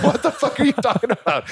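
very high mock-baffled voice, laughing; incredulous. Partner answering the hummingbird news, incredulous. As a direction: imitate a bewildered partner in a high pitch.

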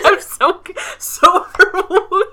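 giggling, I'm so confused; baffled laughter; laughing confusion. Real, not acted.

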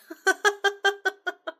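high, loud helpless laughing; burst of laughter. Acted. Vanessa laughing at Jeff's ghost message, loud and helpless. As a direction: crack up uncontrollably. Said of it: "I busted out laughing".

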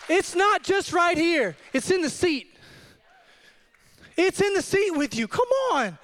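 high, urgent altar call; impassioned preaching. Real, not acted. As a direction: urge the congregation on. Urges congregation to respond.

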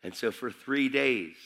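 ordinary talk

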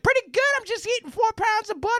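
high, breezy mock girl voice. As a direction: squeak it out like a ditzy friend. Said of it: "She's like".

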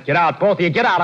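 loud, high, furious order to leave; angry shout. Acted. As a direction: throw them out of the house, yelling. Husband throws visitors out.

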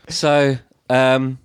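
tipsy, halting start to a speech; drunk speech-maker. Retold or imitated. Drunk best friend starting a leaving speech, tipsily imitated. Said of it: "she was completely pissed".

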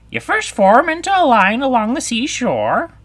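high, loud creature voice; Griffin character voice. Acted. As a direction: call out dance steps as the Griffin. Griffin explains the dance.

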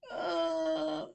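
sudden high pained cry; a sharp high yelp as the slime bites; groan in pain. A narrator's reading. Leather Sky's finger in the slime, pained high cry. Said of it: "Leather Sky groans in pain".

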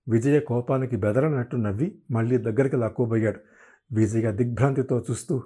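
ordinary delivery, nothing marked

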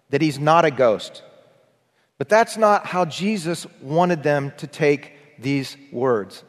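ordinary talk, nothing marked

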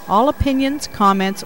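ordinary talk, nothing marked

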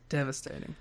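soft, hushed mock grief; mock devastation. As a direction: sigh it out like it's a tragedy.

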